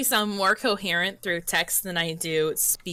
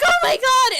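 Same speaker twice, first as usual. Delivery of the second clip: high, loud cry of laughter; high excited Oh my God; delighted shriek; 8 dB louder than usual. Retold or imitated. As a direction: squeal it out in delight. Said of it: "I'll be like".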